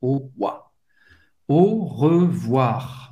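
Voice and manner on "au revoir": "Au revoir" slow and low, final R audible; sounded final R. Shown as a demonstration. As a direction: draw out "Au revoir" and voice the last R. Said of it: "Você vai pronunciar o R no final". ("Au revoir" devagar, pronunciando o R final)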